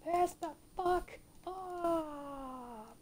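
very slow, drawn out curse; stretched curse. Real, not acted. Gamer dragging out a curse at the boss. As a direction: stretch every word out.

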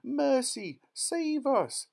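extremely high, loud shriek; panicked yell. A narrator's reading. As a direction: scream for help. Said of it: "yelled Missus Beauregard".

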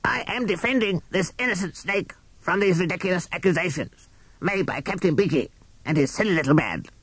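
harsh, croaking barrister crow; rough croak of a courtroom lawyer; deep rasping crow. A narrator's reading. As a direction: rasp it out like an old crow. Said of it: "rasping voice of Q C Crow".

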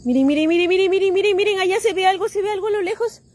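a growing rush of real excitement; excited calling. Real, not acted. Spots something in the sky.

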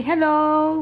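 high, held sing-song notes; sing-song greeting. Shown as a demonstration. Mother coaxing toddler to greet viewers, sing-song lilt.

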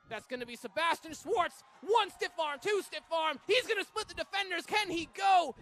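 rising, shouted call of a breakaway; excited play-by-play. Real, not acted. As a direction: yell it as the runner breaks through. Big breakaway run, live call.

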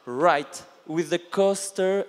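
ordinary talk, nothing marked

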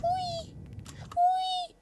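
very high gleeful squeal; high whee. Real, not acted. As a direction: squeal it like a roller coaster. Falling from a height.

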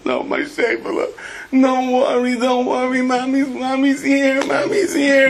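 high, slow cooing mother voice; hammed-up doting mother soothing her little one; fussing mother. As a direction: coo like an anxious mom to her child. Anxious mother voiced in story.

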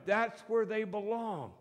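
ordinary talk, nothing marked

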